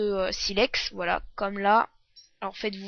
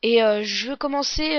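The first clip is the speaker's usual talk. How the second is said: normal talking voice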